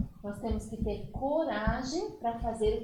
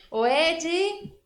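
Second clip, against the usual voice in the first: loud call across the room; 5 semitones higher, 6 dB louder than usual. Real, not acted. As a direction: call him in loudly. Calling the puppet friend in.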